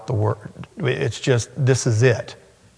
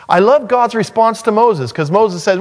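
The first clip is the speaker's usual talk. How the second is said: higher and louder, full-force sermon voice; fiery preaching; 9 semitones higher, 4 dB louder than usual. Acted. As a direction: preach it out with mounting fervour.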